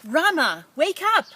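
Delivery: high, loud crowing call; rooster's wake-up call. Acted. Rooster crowing to wake Rama, loud and high. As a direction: belt it out like a rooster at dawn. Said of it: "the rooster crowed".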